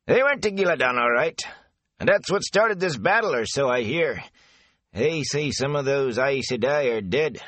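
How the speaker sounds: a garrulous country man passing on rumours; chatty rustic gatekeeper. Acted. Avin passes rumours.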